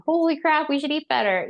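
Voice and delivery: shocked parent. Retold or imitated. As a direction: blurt it like a stunned parent. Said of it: "parents might read it and be like".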